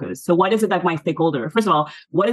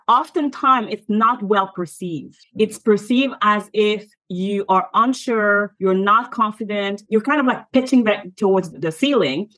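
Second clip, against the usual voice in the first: ordinary delivery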